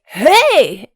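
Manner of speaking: loud, bright call for attention; mock hey. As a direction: grab a stranger's attention. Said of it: "jump in people's faces".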